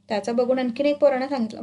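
usual speaking voice

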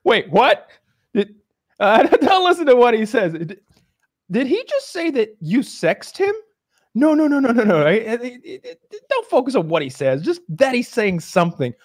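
high, loud, flustered; a scientist scrambling to cover up; panicked professor. Retold or imitated. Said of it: "he goes".

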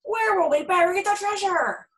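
high, loud worried cry; desperate captain; panicked cry. A narrator's reading. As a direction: cry out in the storm.

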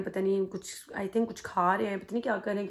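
normal talking voice

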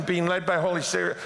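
ordinary delivery

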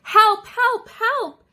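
high, urgent storybook cry; crying for help. A narrator's reading. Little truck stuck in the mud, high cry for help. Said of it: "cried the little blue truck".

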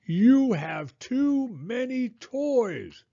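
high, very slow exasperated yell. A narrator's reading. Fed-up mom to son about toys, yelling. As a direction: drag out each word in a high yell. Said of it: "she yelled on her way upstairs".

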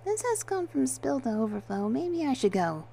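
high, hesitant worry; apprehensive. A narrator's reading. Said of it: "Penny said with apprehension".

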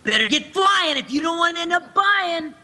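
put-on bouncy, high teen taunt. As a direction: push the inflection up like the director wants. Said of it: "I have to inflect a little more".

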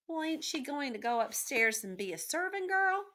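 put-on high, loud servant-girl voice rising at the end; voiced character, pitched well up; Meg's indignant question. A narrator's reading. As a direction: pipe up high in protest. Meg questions the rat in protest.

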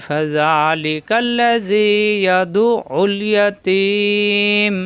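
slow, drawn-out chanted recitation; Quran recitation. Shown as a demonstration. Teacher reciting Quran verse for students, slow chant. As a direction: recite with long tajweed stretches. Recitation joined without stops.

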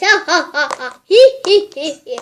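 loud hammed-up ha ha hi hi; hammed-up cackling; mock laughter. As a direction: laugh it out theatrically.

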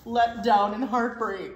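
mock despondent. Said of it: "The word you're looking for is despondent".

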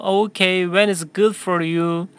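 slow, clear sentence for repeating; teacher modelling a question slowly for students; slow model reading. Shown as a demonstration. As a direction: read it slowly for the class to echo. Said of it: "한 번 더 할까요?".

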